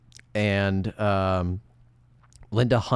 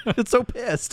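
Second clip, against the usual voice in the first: laughing; 13 semitones higher, much slower than usual. Real, not acted. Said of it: "They're just getting really heated".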